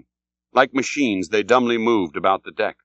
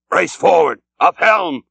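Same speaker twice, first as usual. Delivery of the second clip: loud, higher barked commands; sudden shouted order; 6 semitones higher, 5 dB louder than usual. A narrator's reading. Captain suddenly shouting orders to his crew. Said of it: "cried Ahab suddenly".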